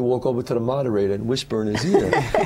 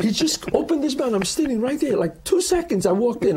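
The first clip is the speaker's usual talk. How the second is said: amused disbelief; 11 semitones higher than usual. Real, not acted. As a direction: repeat it much higher, half laughing. Retelling the awkward moment, amused.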